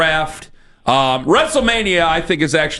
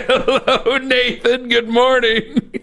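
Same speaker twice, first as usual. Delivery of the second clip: higher, drawn-out greeting through real laughter; laughing greeting; 6 semitones higher, much slower than usual. Real, not acted. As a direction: greet the guest while cracking up. Host cracks up greeting absent guest.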